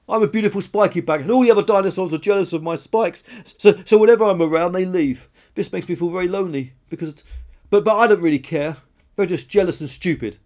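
high, loud weepy rant; tearful protest. Acted. Dinosaur explains her loneliness to a stranger, weepy and defensive. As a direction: pour out hurt, stammering and defiant. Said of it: "wept Karen".

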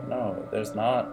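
ordinary talk

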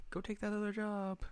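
cutesy encouragement; gentle urging. Retold or imitated. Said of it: "she urges her".

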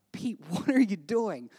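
baffled, laughing question to a friend; amused disbelief. Retold or imitated. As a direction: ask it incredulously through a small laugh. Asking friend caught talking to mirror.

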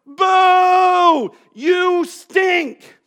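pointing, shouted heckle; booing crowd. Retold or imitated. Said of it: "points, and goes".

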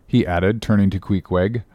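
ordinary delivery, nothing marked

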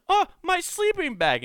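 a hammed-up gasp of horror; shocked dismay. Sarah finding her ruined sleeping bag, mock high dismay. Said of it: "Sarah goes".

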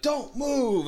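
high, urgent cop's order; retold police command. Cops pointing guns at Brad, commanding yell.

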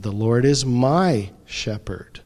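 "my" lifted and pressed in the middle of the phrase; stress on my. Shown as a demonstration. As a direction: make "my" personal and prominent. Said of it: "simply emphasize each word in sequence".

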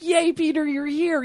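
very high, cheery squeal; mock glee. [name] greeting Peter at the house, mock delighted. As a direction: imitate an overjoyed kid's welcome. Acted gleeful welcome by [name].

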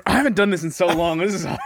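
ordinary delivery

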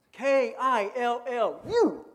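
K I L L U spelled letter by letter, slow and loud. Shown as a demonstration. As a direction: spell it out for emphasis. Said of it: "People want to kill you".

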